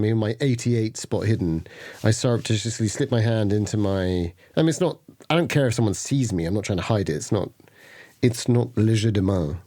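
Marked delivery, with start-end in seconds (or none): none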